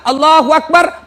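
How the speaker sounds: slow, loud drawn-out takbir; takbir call. Shown as a demonstration. Preacher demonstrating the first takbir, slow and loud. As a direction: call Allahu Akbar slowly like an imam. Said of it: "Takbir keempat".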